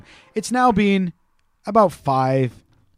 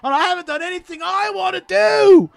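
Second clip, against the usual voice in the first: high, slow tantrum wail; mock Australian tantrum; screaming Australian kid; 11 semitones higher, slower than usual. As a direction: rant like a furious Aussie boy. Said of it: "He's screaming".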